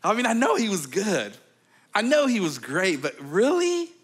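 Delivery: incredulous. Retold or imitated. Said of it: "look and go".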